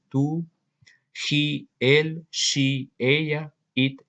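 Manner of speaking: ordinary talk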